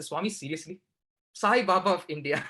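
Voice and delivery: half-laughing, disbelieving complaint to God; exasperated disbelief. Retold or imitated. Exasperated plea to the sky.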